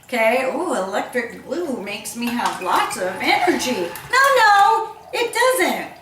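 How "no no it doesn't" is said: laughing. Real, not acted. Laughing at blue energy drinks.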